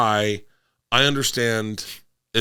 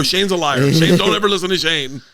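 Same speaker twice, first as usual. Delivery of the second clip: raised, louder imitation of indignant girls; mock outraged protest; 7 semitones higher, 5 dB louder than usual. Girls denying the beers to dad, mock outraged. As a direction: cry foul in a higher, louder voice.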